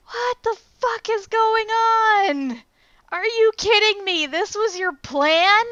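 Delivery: high-pitched, drawn-out incredulous outburst; exasperated disbelief. Retold or imitated. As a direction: stretch it out in appalled disbelief. Acted disbelieving reaction to the film scene.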